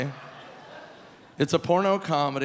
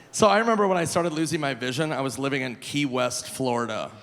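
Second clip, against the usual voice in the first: ordinary talk, nothing marked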